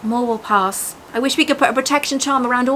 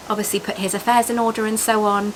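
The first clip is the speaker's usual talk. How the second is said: plain reading voice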